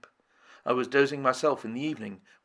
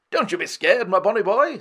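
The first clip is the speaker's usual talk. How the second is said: higher, louder hearty lord; cheerful comfort to a frightened boy; hearty reassurance; 8 semitones higher, 6 dB louder than usual. A narrator's reading. Lord John soothing the cringing Indian, hearty. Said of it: "said Lord John".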